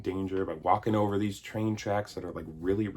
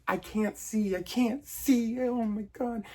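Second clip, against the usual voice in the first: put-on much higher, straining kid's whine; hero boy's voice; 13 semitones higher than usual. Hero boy straining to see Santa, high and whiny. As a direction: whine like a small boy who can't see. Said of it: "Little hero boy's like".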